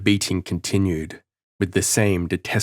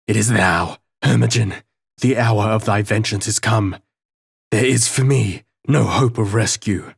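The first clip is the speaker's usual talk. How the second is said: raised, desperate howl at the ghost; 2 semitones higher, 4 dB louder than usual. A narrator's reading. Prisoner howling at his victim's ghost, raised desperate cry. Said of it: "I howled out".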